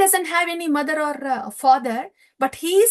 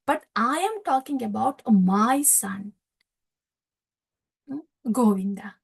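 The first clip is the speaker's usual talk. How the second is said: tender father; 3 semitones lower than usual. Retold or imitated. Nanda repeats, softer, ending in whisper.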